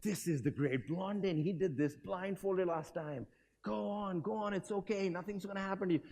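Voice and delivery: a hint of a persuasive crowd plant; coaxing plants. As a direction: talk a nervous stranger into it.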